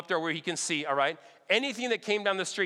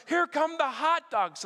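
higher, slow announcement of a thrilled neighbour; excited announcement; 7 semitones higher, slower than usual. Retold or imitated. Neighbour spotting the hot dog float, slow high excited call. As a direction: call it out slowly like the best news of the day.

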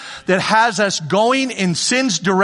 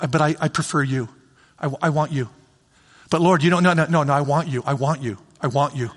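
tender God; 5 semitones lower, faster than usual. Acted. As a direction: say it gently like a loving father. Voicing God's tender words.

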